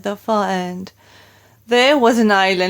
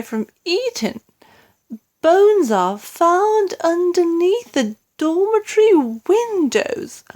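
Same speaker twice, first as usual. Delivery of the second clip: queen reading; 8 semitones higher than usual. A narrator's reading. Queen reading headlines aloud.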